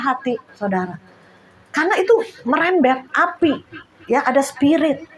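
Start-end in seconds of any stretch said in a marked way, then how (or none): none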